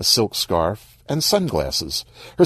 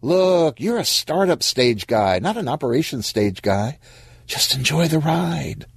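breezy advice, pitched up a little; lightly voiced breezy friend; 8 semitones higher than usual. Monique advises gloomy Stan, lightly voiced and breezy. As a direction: lightly play a friend brushing off worries.

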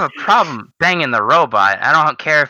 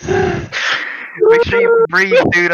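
laughing; 4 dB louder, much slower than usual. Real, not acted. Laughing fit, gasping.

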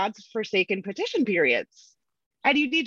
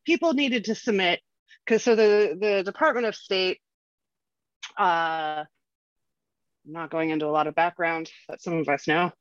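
slightly winded from exercise bike; out of breath; 4 semitones lower than usual. Real, not acted. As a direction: talk while pedaling hard. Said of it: "I'm out of breath while I am talking".

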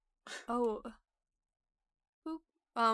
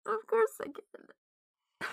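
fake crying; 8 semitones higher, much slower than usual. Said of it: "She's using her fake cry".

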